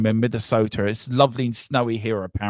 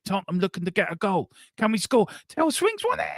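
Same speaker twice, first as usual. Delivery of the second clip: high, rising play-by-play; excited match commentator, pitch climbing with the move; live football commentary; 8 semitones higher than usual. Real, not acted. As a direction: call the attack live, building to a high peak.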